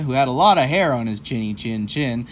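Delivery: ordinary delivery, nothing marked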